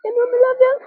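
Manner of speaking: put-on high little-girl voice, then choked up; sweet child voice turning tearful; imitating her daughter.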